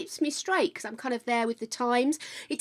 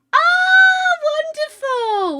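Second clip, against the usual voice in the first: very high, loud squeal of delight; delighted exclamation; 9 dB louder, much slower than usual. Real, not acted. Streamer hearing good news from a viewer, delighted squeal. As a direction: swoop down from a high delighted cry.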